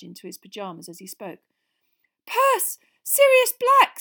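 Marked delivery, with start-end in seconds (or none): none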